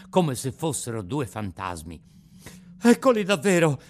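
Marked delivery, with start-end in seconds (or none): 2.8-3.8 sudden startled gasp of fear; frightened. Acted. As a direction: blurt it out in fright. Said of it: "disse dentro di sé".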